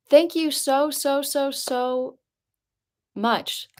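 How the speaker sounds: high, breaking thanks on the verge of tears; fighting back tears while thanking; touched. Real, not acted. To the donor, near tears, breaking. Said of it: "I'm gonna cry".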